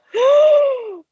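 sharp, high, loud gasp; shocked gasp. Retold or imitated. Expectant mother reliving the thirty-week news, shocked gasp.

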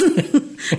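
a brief real laugh, falling away; quick amused laugh; short laugh. Real, not acted. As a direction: let a small laugh slip out. Laughs after her resolve.

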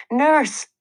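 put-on sick child calling out for the nurse at night; calling out loud. A narrator's reading. A child in the hospital calls for the night nurse, high call out. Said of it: "I yelled, as loudly as I could".

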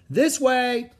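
high, loud directing call. A narrator's reading. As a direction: call people over to the boat. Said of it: "a man called".

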